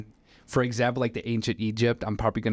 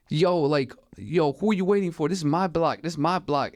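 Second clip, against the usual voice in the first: higher-pitched, insistent retelling; mock confrontation; 5 semitones higher than usual. Gunman warns the driver off his block, mock confrontational. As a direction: act out someone defending his turf.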